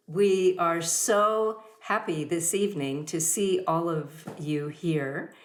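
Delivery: ordinary talk, nothing marked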